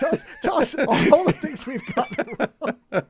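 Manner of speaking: slow, laughing through the words. Real, not acted. Begging viewers to correct them, laughing slowly. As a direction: let the laughter run through the plea.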